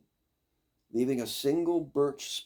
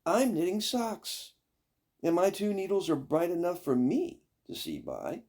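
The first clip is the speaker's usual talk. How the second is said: second daughter excusing herself; 7 semitones higher, 3 dB louder than usual. Acted. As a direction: draw it out, pleased with herself. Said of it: "said the second daughter".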